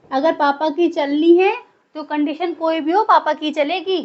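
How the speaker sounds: normal talking voice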